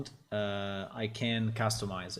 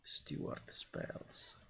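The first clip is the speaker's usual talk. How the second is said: breathless whisper while typing; whispered to self; 3 semitones higher, 16 dB quieter, much slower than usual. Shown as a demonstration. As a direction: whisper the name as you type it. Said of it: "I will call it".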